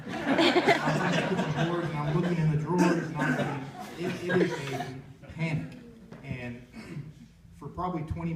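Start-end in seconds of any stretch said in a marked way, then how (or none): 0.1-5.7 high, loud laugh through the words; telling it through nervous laughter; laughing while telling. Real, not acted. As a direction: laugh helplessly at the memory. Laughing retelling panic search.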